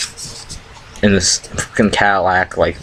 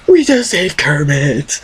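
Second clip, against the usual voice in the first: high, slow, dopey pride; mock stoned triumph; proud stoner; 8 semitones higher, slower than usual. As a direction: savor it like a heroic deed. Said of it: "he's like".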